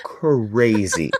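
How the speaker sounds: high, drawn-out incredulity; exasperated disbelief. Real, not acted. Telling co-host Demi about the wristband rule, exasperated. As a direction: stretch it out high, utterly exasperated.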